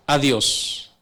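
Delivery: ordinary talk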